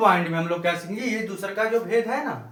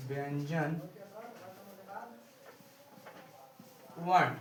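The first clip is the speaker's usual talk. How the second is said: dictation; 3 semitones lower, 14 dB quieter, slower than usual. Shown as a demonstration. Dictating the definition word by word.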